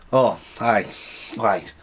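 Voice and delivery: ordinary talk, nothing marked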